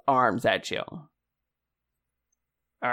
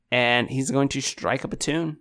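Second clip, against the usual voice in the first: ordinary talk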